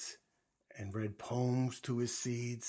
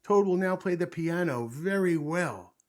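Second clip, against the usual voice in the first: loud, raised stage announcement; strange announcer voice; 7 semitones higher, 10 dB louder than usual. A narrator's reading. Strange voice introduces Toad's piano act, loudly announcing. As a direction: proclaim it to the whole theater. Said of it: "said the strange voice".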